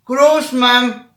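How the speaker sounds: loud call for grandma. Retold or imitated. Family calling grandma loudly to help. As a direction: call her loudly from the room.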